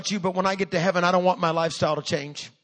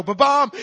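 loud, high mock trumpet call; sudden booming "ba-bomb"; imitated trumpet blast; 6 semitones higher, 8 dB louder than usual. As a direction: blare it out like a horn.

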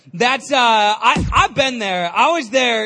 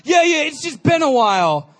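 defensive bluff; 5 semitones higher, 4 dB louder than usual. Retold or imitated. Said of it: "And I'm like".

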